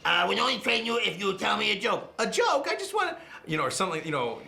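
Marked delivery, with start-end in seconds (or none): 0.0-2.1 much higher, bright cartoon mentor voice; voicing King Kai to Goku. Shown as a demonstration. Voice actor acting both roles, as the mentor, high cartoon voice. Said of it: "it'd be like". 2.2-3.2 very high, louder cartoon hero voice; Goku replying. Shown as a demonstration. Same actor now answering as Goku, high excited cartoon voice. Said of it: "I like to do it one at a time".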